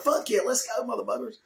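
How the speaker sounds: high-pitched eager cheer; gleeful, voice jumping way up; thrilled to be hauled off to jail; excited. Retold or imitated. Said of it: "I've never seen somebody so happy to go to jail".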